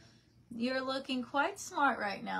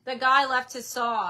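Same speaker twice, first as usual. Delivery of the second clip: louder call, repeating it to dad; 3 semitones higher, 8 dB louder than usual. Real, not acted. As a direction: call across the house to dad. Calling to father across house.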